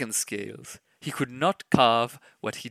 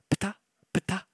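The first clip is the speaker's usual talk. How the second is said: tail slaps; 6 semitones higher, 4 dB quieter than usual. A narrator's reading. Fish tails slapping.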